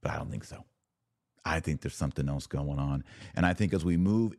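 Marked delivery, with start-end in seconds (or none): 0.0-0.7 whispered aside. Real, not acted. Aside after sarcastic okay.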